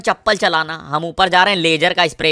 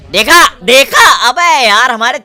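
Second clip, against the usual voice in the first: gloating shout; 5 semitones higher, 8 dB louder than usual. Real, not acted. Gloating after kill.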